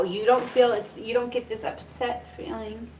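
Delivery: ordinary talk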